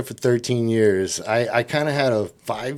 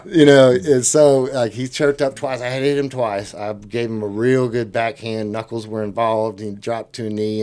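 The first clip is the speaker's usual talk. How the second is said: ordinary delivery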